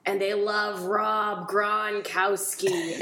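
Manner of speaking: slow exaggerated Boston drawl; Boston accent. Shown as a demonstration. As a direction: lay the Boston accent on thick. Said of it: "kinda just talk like this".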